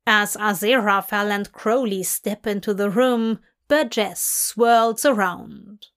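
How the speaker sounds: plain reading voice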